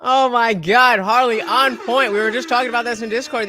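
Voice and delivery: loud and delighted; excited. Real, not acted. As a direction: cheer the point loudly. Excited reaction.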